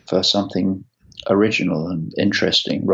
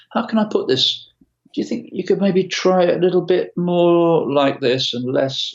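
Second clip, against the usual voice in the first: much higher, sugary diplomatic tone; mock diplomatic producer, pitched way up; overly polite tactful voice; 7 semitones higher than usual. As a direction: tiptoe around the criticism in a high polite voice. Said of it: "tactful and trying to be diplomatic".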